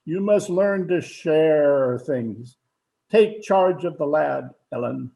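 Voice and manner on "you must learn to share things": slow lecture. Acted. Father lecturing son.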